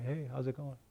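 soft, offhand hello in a little skit; casual mock greeting. Ex-employee dropping by old job, soft casual greeting. Acted visit to old workplace.

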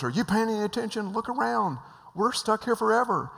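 high, loud panicky protest; panicked yelling. Retold or imitated. Stranded passenger pleading with calm pilot, high panicky yell.

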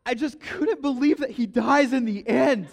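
put-on high, loud voice of a teenage boy with a laugh; emotional teen. Owen apologizing to class, blurting high and loud with a laugh. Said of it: "Owen gets very emotional".

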